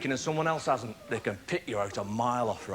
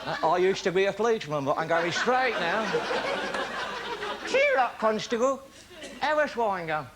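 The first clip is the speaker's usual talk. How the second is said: higher, louder, cheekily humble replies; sheepish mock apology laced with laughter; mock meek; 8 semitones higher, 4 dB louder than usual. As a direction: play the innocent while taking the mickey. Driver answering cop with mock meekness.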